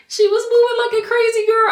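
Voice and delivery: high, loud excited gush. Real, not acted. Telling her husband about the scan, thrilled.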